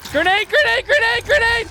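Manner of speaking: high, loud warning shout; grenade warning yell. Real, not acted.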